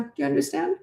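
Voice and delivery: soft upward calm check-in; calm question. Shown as a demonstration. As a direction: ask it gently, in charge. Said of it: "Like that, that tone".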